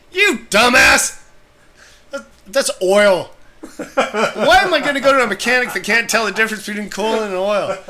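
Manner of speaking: half-laughing mock fury at the mechanic; retold angry outburst. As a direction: rant at the dumb mechanic.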